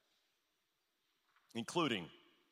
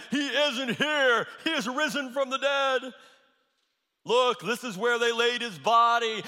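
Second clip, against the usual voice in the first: joyful announcement; 6 semitones higher, 4 dB louder than usual. Acted. Angel announcing the resurrection.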